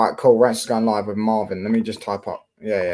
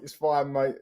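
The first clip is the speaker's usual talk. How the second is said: ordinary talk, nothing marked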